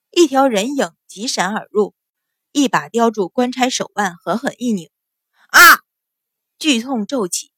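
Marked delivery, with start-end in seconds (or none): 5.5-5.8 loud, high-pitched yelp of agony; sudden pained scream. A narrator's reading. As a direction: cry out as the wrist is twisted. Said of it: "杀猪般叫了起来".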